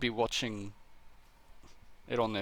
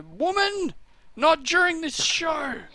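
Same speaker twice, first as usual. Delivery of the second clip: much higher, louder, slowed mock husband snapping; mock stern husband; 7 dB louder, slower than usual. Husband voiced scolding wife mid-show.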